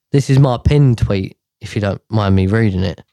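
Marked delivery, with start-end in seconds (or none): none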